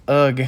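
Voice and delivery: short exasperated "ugh"; frustrated groan. Real, not acted. Player groans as she evades me, frustrated. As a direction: let out a fed-up groan.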